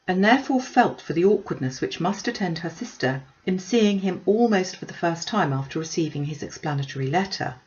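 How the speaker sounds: ordinary delivery, nothing marked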